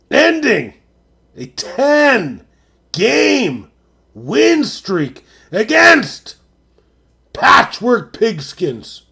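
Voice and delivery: slow, drawn-out mock sportscaster call; hammed-up announcer reading the final score; dramatic announcer. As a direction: stretch each word like a big-game announcer. Hyping up a game result.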